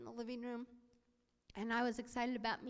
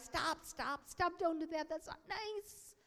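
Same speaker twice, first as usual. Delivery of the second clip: plea climbing high at the end; acted flustered scolding; 5 semitones higher, slower than usual. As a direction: try to shush children, ending high and slow. Himself scolding mimicking kids.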